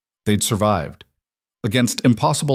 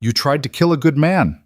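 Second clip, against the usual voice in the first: shaking with fury; 6 semitones higher than usual. A narrator's reading. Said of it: "her voice shaking with fury".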